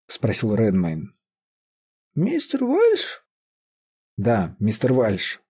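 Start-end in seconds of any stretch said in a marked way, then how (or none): 2.1-3.3 put-on very high, drawn-out questioning old voice; old woman querying. A narrator's reading. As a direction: ask back like a half-deaf old woman. Deaf old woman queries the name.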